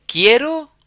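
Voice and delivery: "Quiero" shot up high and loud with a rising tune; emphatic high quiero. Shown as a demonstration. Teacher stressing the Spanish verb to students, emphatic. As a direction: hit "Quiero" hard and high. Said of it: "teacher emphasizing word".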